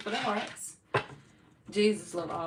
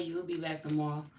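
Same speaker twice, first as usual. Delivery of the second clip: ordinary talk, nothing marked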